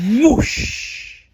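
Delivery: loud breathy whoosh, very high; rocket whoosh. Acted. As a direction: blast it off like a rocket. Rocket zooms off.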